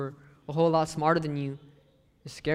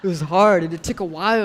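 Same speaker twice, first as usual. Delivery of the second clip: laughing; 10 dB louder than usual. Real, not acted. Laughing at tortilla memory.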